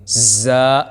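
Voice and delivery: زا said firmly, with a hard, forceful sound. Shown as a demonstration. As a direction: press زا out hard. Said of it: "اس کو سختی کے ساتھ پڑھنا ہے". (زا سختی سے)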